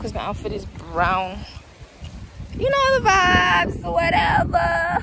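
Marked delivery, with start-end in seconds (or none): 2.6-5.0 sing-song. Acted. Posing for photos, sing-song.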